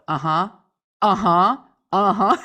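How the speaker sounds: mock compelled listener chiming in; loud, repeated rising uh-huhs; prompted listener acknowledgements. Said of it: "like they have to go".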